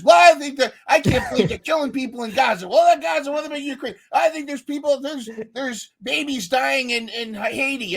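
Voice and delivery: high, loud mock outrage, running fast; shrill-free but high and loud mock rant; mock heated rant. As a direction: ham up an overexcited Spaces ranter.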